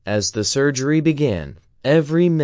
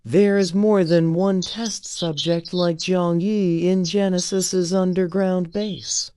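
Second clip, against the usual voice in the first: higher, slow and weary woman's voice; exhausted, helpless; 8 semitones higher, slower than usual. A narrator's reading. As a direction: let the words drag with helplessness. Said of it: "full of exhaustion and helplessness".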